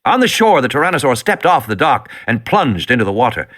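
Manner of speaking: ordinary delivery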